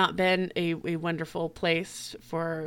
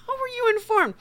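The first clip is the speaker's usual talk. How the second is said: high, louder mocking disbelief; incredulous retort; 5 dB louder than usual. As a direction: toss the question back, scoffing.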